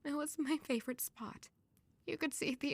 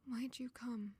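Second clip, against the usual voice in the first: whispered question; 2 semitones lower, 9 dB quieter than usual. Acted. Withdrawn grieving girl murmurs.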